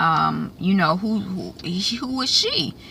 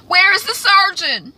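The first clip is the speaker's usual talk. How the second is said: hammed-up sobbing complaint; dying man crying out; 11 semitones higher, 5 dB louder than usual. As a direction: cry it out from the deathbed. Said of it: "Sir George, he's in the background".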